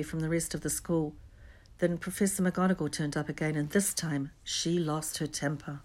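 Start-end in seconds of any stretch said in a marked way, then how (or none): none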